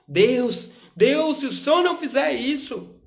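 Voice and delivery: hammed-up believer pressuring God; shouted mock ultimatum in prayer; mock desperate plea.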